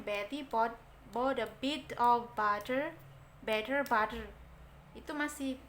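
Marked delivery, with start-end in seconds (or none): 0.0-4.3 Indonesian accent, every T in "Betty" and "butter" said crisp and hard; Indonesian-style English. Shown as a demonstration. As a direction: read it the way an Indonesian speaker usually would, clear hard Ts. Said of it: "Kalau bahasa kita biasanya". (pengucapan Inggris logat Indonesia, T jelas)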